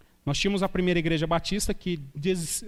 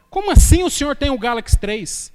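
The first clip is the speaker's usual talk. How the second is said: loud, higher-pitched indignant outburst; mock shocked disbelief; 4 semitones higher, 8 dB louder than usual. Congregant shocked at the pastor's old Galaxy phone, mock disbelief. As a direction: imitate a scandalized listener, loud and high.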